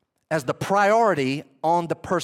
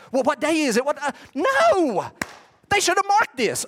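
a laughing mock shout of dismay; mock alarm; 8 semitones higher than usual. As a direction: act out the panicked pilgrim with a laugh. Pilgrims panicking over the defilement.

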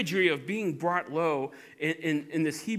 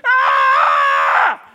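low, loud bellowing yell; amygdala scream; 11 semitones lower, 16 dB louder than usual. Acted. Amygdala bellowing in alarm at the water tower, loud yell. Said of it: "the amygdala goes".

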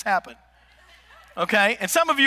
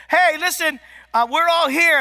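higher, louder call into the phone; loud speakerphone; 7 semitones higher, 4 dB louder than usual. Retold or imitated. Mom shouts into the speakerphone at her son, higher and louder. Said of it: "She does this".

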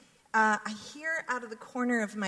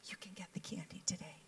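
soft whisper; 12 dB quieter, faster than usual. Retold or imitated. Woman giving kids dollars.

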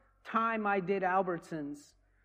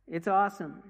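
ordinary talk, nothing marked

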